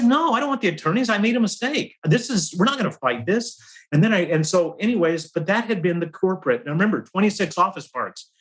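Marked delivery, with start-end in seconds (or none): 0.0-3.6 retold protest, pitched up and a bit fast; insistent retelling. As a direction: replay the urgent no to the boss, pitched high. Insisting to boss, no attorneys.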